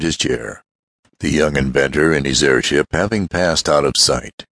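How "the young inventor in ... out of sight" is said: ordinary delivery, nothing marked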